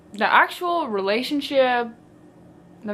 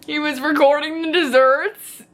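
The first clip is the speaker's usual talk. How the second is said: higher, strained repeat of disbelief; cringing disbelief; 4 semitones higher, 4 dB louder than usual. Real, not acted. Reader appalled by a character filming desserts, incredulous. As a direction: say it as if it pains you.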